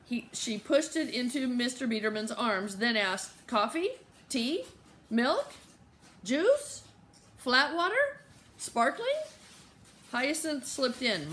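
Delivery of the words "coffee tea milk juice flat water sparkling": each item a separate rising question; eager drink offers. A narrator's reading. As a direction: offer one option after another, pitch climbing. Flustered hostess rattling off drink offers.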